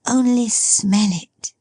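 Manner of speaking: unvoiced hushed whisper as Meg; playful whispered girl. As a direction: breathe it secretly. Meg's lines, whispered.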